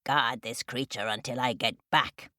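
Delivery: low crow voice giving orders; ordering crow. A narrator's reading. Crow orders chicks to guard turtle, low command. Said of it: "ordered Binema".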